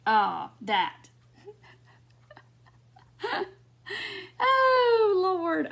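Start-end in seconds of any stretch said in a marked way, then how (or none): 3.2-5.7 a high, crying squeal of laughter; breaking up with laughter; laughing. Real, not acted. Host cracking up at the chat's jokes, laughing.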